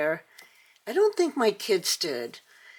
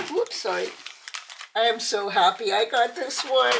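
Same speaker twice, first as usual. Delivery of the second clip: ordinary delivery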